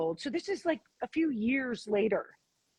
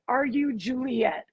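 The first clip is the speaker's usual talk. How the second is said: ordinary talk, nothing marked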